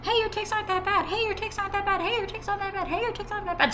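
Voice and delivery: very high, squeaky, rapid repeated chant; repetitive tic. Real, not acted. Ticcing on camera, high squeaky repeated phrase. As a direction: chirp it identically, up high.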